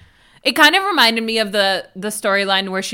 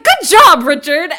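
high, loud gushing praise in imitation; enthusiastic and impressed; 8 semitones higher, 10 dB louder than usual. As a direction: cheer him on, hammed-up. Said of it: "so enthusiastic and impressed".

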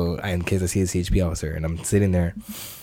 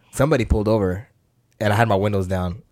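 normal talking voice

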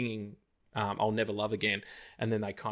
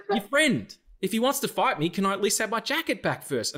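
indignant plea; 9 semitones higher than usual. Retold or imitated. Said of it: "I said".

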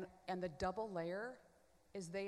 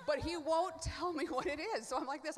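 laugh, then higher and louder; 7 semitones higher, 6 dB louder than usual. Real, not acted. Laughing about her soul faking the crying, higher and louder. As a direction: laugh at yourself, then push on.

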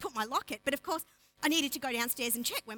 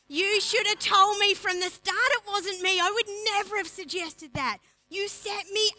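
higher, louder mock outrage; hammed-up betrayed accusation; mock indignant; 6 semitones higher, 6 dB louder than usual. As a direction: Haman protesting to the king, high and loud. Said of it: "Haman sitting there going".

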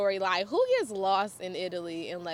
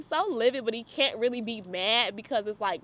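normal talking voice

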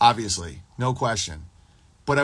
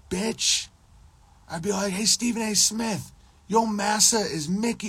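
mock taunt; 7 semitones higher than usual. Taunting Stephen A Smith, high mocking jeer. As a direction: jeer at him like a playground bully.

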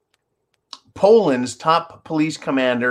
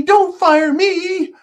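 "don't fire me" stretched out slowly, very high; drawn-out whiny plea; 5 dB louder, much slower than usual. Pretend. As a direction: beg slower and higher, wailing. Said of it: "Can you imagine it?".

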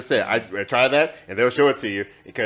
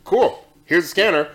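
cop calling a bluff, raised pitch and volume; imitated police officer; 6 semitones higher, 4 dB louder than usual. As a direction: play the officer putting someone in their place. Retells officer's unimpressed reply.

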